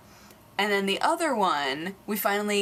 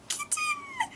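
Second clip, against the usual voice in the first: very high delighted squeal; squealing kitten; 7 dB quieter than usual. Retold or imitated. Friends spotting a stray kitten at night, delighted high squeal. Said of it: "we were like".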